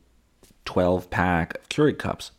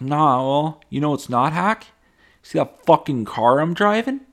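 higher, louder smug boast; smug brag; 9 semitones higher, 5 dB louder than usual. Retold or imitated. Influencer bragging to family about his car, higher smug. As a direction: rub the car in their faces. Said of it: "And you're like".

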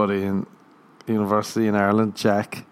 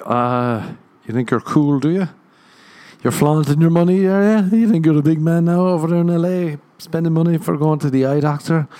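mock taunting Irish buddy; 7 semitones higher than usual. Imitating Irish friend mocking him for seeing doctor, jeering. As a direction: rib him like a scornful mate.

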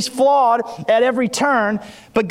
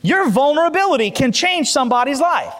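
preaching at full force, raised and high; 4 semitones higher than usual. Acted. Pastor preaching to the church about testimonies, full force. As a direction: fire up the congregation from the pulpit.

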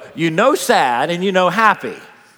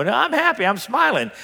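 high-pitched, insistent false cheer; fake happy; 8 semitones higher than usual. Preacher mocks people who pretend they're happy, falsely cheery. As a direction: insist on being happy, unconvincingly. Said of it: "too many people who do that".

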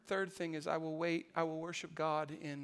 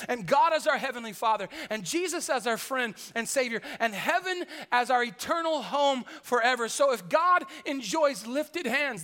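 louder and higher, building to a shout; full-force preaching; 3 semitones higher, 8 dB louder than usual. Real, not acted. Preacher listing what God has done, building to a shouted climax. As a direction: preach it home, rising with every line.

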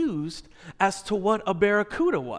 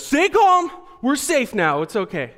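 panicked reassurance; 6 semitones higher, 4 dB louder than usual. Retold or imitated. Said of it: "I'm like".

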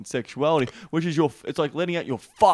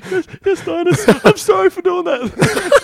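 high, loud, slow squeaky pleading through laughter; laughing fake-terrified apology, much higher and louder; mock cowering apology; 13 dB louder, slower than usual. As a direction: beg for forgiveness like a scared kid.

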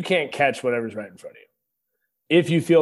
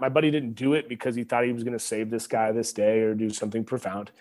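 usual speaking voice

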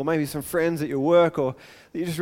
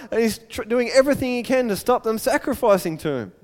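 ordinary delivery

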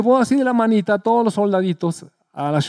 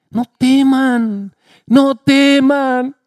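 slow, raised, drawn-out comfort; reassuring; 5 semitones higher, much slower than usual. Acted. Jesus tells his disciples not to fear, slow and tender. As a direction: soothe a frightened crowd.

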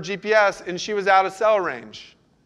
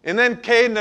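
ordinary talk, nothing marked

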